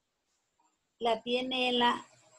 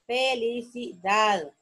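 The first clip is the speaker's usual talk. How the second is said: felicidad slowly in syllables, landing on DAD; final-syllable stress; slower than usual. Shown as a demonstration. Teacher showing an aguda word, felicidad drawn out to DAD. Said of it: "es una palabra aguda".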